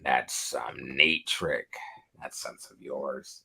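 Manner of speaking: low, slow, soft mock villain taunt; lightly voiced sinister Goblin, drawn out; mock Goblin menace. Fan quoting Goblin's taunt to Peter, low and slow. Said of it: "He's like".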